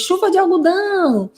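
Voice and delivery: high playful call of a mother; mãe brincando. Retold or imitated. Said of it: "Aí a mãe pegou". (mãe brincalhona, em tom agudo)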